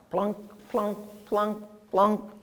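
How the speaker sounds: plucked twangy plunks; banjo plunk. Shown as a demonstration. A teacher imitating green frog plunks. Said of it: "plunk like banjos".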